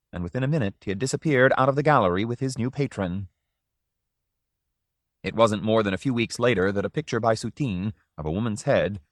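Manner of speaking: ordinary delivery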